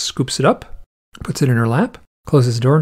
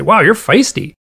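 higher-pitched imitated exclamation; mock amazement; 6 semitones higher, 4 dB louder than usual. Veterinarians to the biting owl, mock amazement. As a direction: exclaim it with a high, impressed lift.

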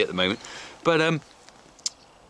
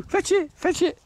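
much higher, excited sing-song to the dog; dog-talk voice. Real, not acted. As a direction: coax the dog to get ready for the throw. Urging the dog to fetch.

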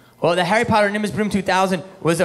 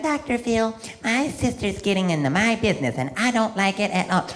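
a touch higher whiny guest; complaining guest; 3 semitones higher than usual. Retold or imitated. Woman complaining about her sister to Doctor Phil. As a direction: whine to the host about your sister. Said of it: "She's like".

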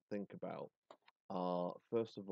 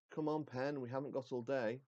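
calling out; 6 semitones higher than usual. Acted. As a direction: call out impatiently to the stage. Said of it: "Mister Beaconsfield calls out".

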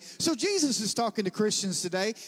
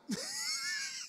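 high, softer put-on cackle; obnoxious laugh; 8 dB quieter than usual. Preacher re-enacts laughing after asking for more mockery, high obnoxious laugh. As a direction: wheeze out a mock laughing fit. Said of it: "I was laughing obnoxiously".

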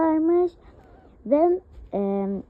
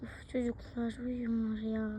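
quiet musing; 2 semitones lower, 14 dB quieter than usual. Acted. Tired parent musing alone.

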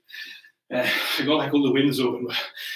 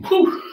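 high exhausted whew; 4 dB louder than usual. Real, not acted. Exhaling after an exercise.

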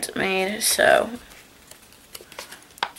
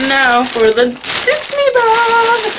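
excited announcement; 14 semitones higher, 6 dB louder than usual. Real, not acted. Announcing next bag.

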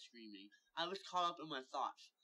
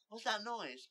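high, louder alarmed query; pitched-up, urgent question; startled question; 8 semitones higher, 7 dB louder than usual. Acted. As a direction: ask it with sudden alarm.

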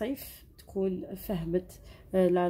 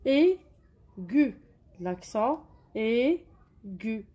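slow demonstration; 3 semitones higher, 4 dB louder, much slower than usual. Shown as a demonstration. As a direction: draw out إيغو like a teacher naming it. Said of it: "زدنا له لاكسون".

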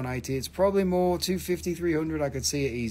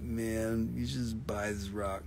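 lower, flat-toned mock bored dealer; boring rock seller; 5 semitones lower than usual. Gem seller mocking dull sellers, a lightly done bored drone. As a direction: drone it out like the dullest seller alive. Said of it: "Because everybody else is so boring, man".

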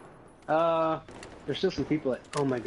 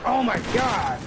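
panicked exclamation; 9 semitones higher, 4 dB louder, slower than usual. Real, not acted. Overwhelmed by enemies in game.

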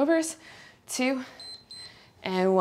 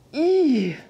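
strained squeal; 6 semitones higher, 5 dB louder than usual. Real, not acted. Straining mid workout.

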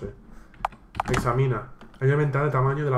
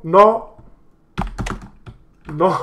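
loud, high shouted no; emphatic refusal; 5 semitones higher, 8 dB louder than usual. Real, not acted. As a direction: shout back a firm refusal. Answers the game's "are you sure.

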